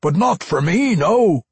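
high, loud dwarf; dwarf vampire. A narrator's reading. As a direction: bellow it as a belligerent dwarf vampire.

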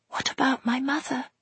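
high, faint whisper. Acted. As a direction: barely whisper it, high and fragile. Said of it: "Meggie could only whisper".

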